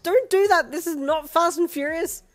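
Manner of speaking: high, loud exasperated shout; scolding yell. Acted. Scolding Darby hanging off the car, loud yell. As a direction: tell him off at full volume.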